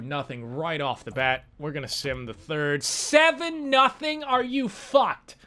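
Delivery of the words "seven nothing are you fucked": high, slow, incredulous outburst; stunned disbelief. Real, not acted. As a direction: stretch each word out in shock. Shock at the score.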